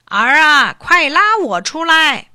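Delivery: high, loud, slow cry for help; old man shouting up from the barrel; calling out. A narrator's reading. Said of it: "桶里有一个声音继续叫着". (老头子在桶里大声呼救)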